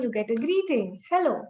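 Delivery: normal talking voice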